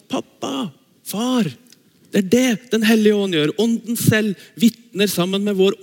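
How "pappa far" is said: put-on tender child's call. Shown as a demonstration. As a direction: call «Pappa, far» with childlike closeness. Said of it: "men sånn".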